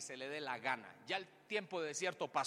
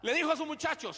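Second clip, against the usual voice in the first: dramatic narration; 11 semitones higher, 9 dB louder than usual. A narrator's reading. Pastor narrating David's past to the church, dramatically. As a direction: draw the congregation into the story.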